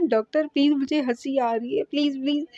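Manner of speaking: laughing while talking. Retold or imitated. Patient laughing as the doctor checks her tummy, giggly plea. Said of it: "वो औरत हंस के कहती है".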